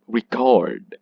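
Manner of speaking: record with weak re and long CORD; stress on second syllable. Shown as a demonstration. Teacher showing the verb, stress on CORD in record. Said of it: "That is the verb".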